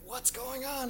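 high, startled question; puzzled and alarmed; confused. Retold or imitated. Confused dad in the dream.

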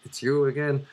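a hint of a put-on man; lightly voiced man greeting someone known; attempted man's voice. Shown as a demonstration. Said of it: "here's my accent of a man".

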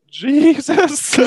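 high squealed Jesus. Retold or imitated. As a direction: shriek the punchline. Comic punchline pitch jump.

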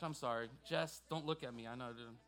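ordinary talk

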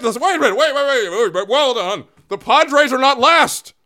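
excited astonished news flash; stunned disbelief. Real, not acted. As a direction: announce the shock to the whole audience. Stunned by the standings.

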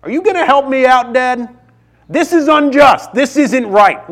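high-pitched imitation of an upset young son; high, indignant childish appeal; upset child pleading. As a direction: plead with dad like a wronged little boy. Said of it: "He's asking me".

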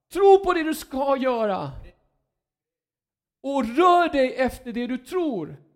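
preacher thundering God's charge; urgent command. Acted. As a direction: drive the people to get going. Preacher voicing God urging action.

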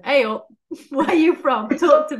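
cheeky pretend interviewer, laughing through it; playful street interviewer. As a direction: hail a passer-by with a pretend microphone. Playing interviewer with microphone in street.

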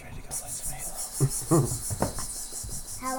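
soft unvoiced whisper, slow. Real, not acted. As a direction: whisper it secretly. Whispered invitation after the show.

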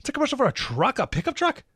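high, rising, disbelieving; mock-baffled viewer, voice jumping up; incredulous mock disbelief. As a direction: act stunned it's a truck ad. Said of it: "and you're like".

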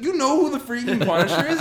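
high, loud mock outburst; freaking out. Defender to Jessica on not knowing the Punisher, mock freak-out. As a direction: blurt it out in incredulous alarm. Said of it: "she's, like, freaking out".

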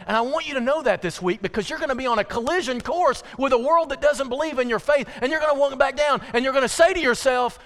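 high, loud pulpit exhortation; impassioned preaching. Real, not acted. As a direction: drive it home to the congregation. Preacher exhorts congregation at full force.

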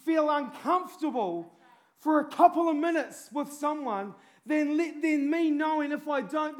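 loud, high, urgent preaching; fiery preaching. Real, not acted. Preacher urging his congregation to share the gospel, fiery preaching. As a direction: plead with the crowd to go share.

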